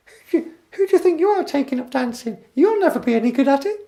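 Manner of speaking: very high, loud falsetto cartoon squeak; piercing falsetto mouse voice; Mickey Mouse. Shown as a demonstration. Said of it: "if Mickey Mouse said it".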